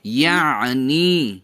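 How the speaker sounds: یعنی stretched slowly, throat squeezed in the middle; exaggerated ain. Shown as a demonstration. Teacher gives a memory aid, یعنی with exaggerated ain. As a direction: draw out یعنی, leaning on the throat sound. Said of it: "عین کو یاد کرنے کا ایک idea".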